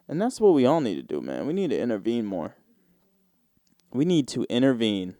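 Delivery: normal talking voice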